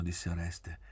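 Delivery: usual speaking voice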